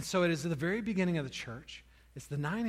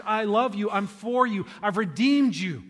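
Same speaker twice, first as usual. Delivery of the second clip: louder, proclaiming each phrase with force; fervent preaching; 4 semitones higher, 10 dB louder, slower than usual. Acted. Pastor proclaiming God's message to church, loud fervent preaching. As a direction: proclaim God's love boldly.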